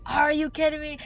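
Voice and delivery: can't believe this is happening; exasperated outburst. Retold or imitated. Missed the exit again.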